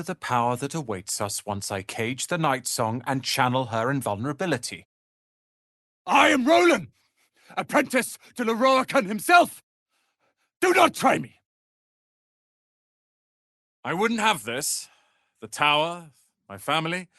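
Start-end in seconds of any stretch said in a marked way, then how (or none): 6.1-11.4 high, loud indignant warning; proud apprentice threatens; haughty threat. Acted. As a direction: puff up and warn them off. Threatening an intruder.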